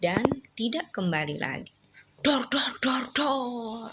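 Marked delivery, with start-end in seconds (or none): 2.2-3.9 playful "dor, dor" gunshot sounds; little bang-bang noises to thrill a child listener; mock gunfire. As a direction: pop out "dor dor" like toy-gun shots for the child. Parent mimics gunfire in story.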